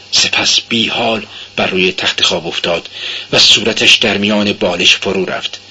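ordinary delivery, nothing marked

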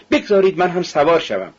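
put-on a young man calling to join in. A narrator's reading. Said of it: "فریاد زد". (فریاد ملایم جوانی مشتاق)

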